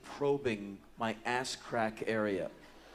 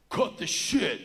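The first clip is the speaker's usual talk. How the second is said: sudden louder, higher order; angry big fella; 5 semitones higher, 5 dB louder, slower than usual. Retold or imitated. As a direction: snap it loudly at someone crowding you. Big fella barks at narrator.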